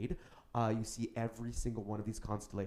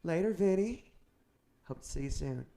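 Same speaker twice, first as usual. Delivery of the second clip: witch's farewell; 7 semitones higher, 7 dB louder than usual. Acted. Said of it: "She goes".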